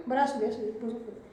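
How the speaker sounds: low, soft aside; quiet letting-be. Real, not acted. Teacher gives up on waking a sleeping pupil, quiet and low. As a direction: drop the voice right down, letting it go.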